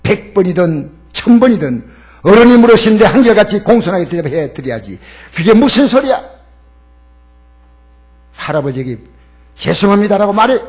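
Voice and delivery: loud stern rebuke; a mother loudly scolding her son for his rudeness. Retold or imitated. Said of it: "크게 호통을 쳤습니다".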